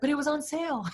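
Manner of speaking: husband defending his grocery splurge, mock sheepish; imitating dad. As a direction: whine the excuse like a caught husband. Dad excusing extra purchases.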